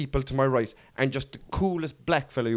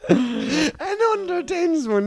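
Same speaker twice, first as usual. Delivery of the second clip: laughing; 14 semitones higher, much slower than usual. Real, not acted. Laughing fit repeating friend's jab.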